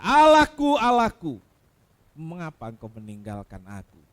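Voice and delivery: loud anguished cry that sinks to a faint whisper-low end; dying man's cry, out loud then spent; loud cry fading out. Acted. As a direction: cry out from the cross, then let the breath die away. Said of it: "sepotong kata itu saja".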